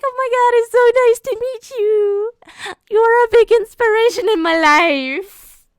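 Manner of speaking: very high, starstruck fangirl gush. Retold or imitated. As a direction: squeal it out as if meeting your idol. Acts out meeting her idol.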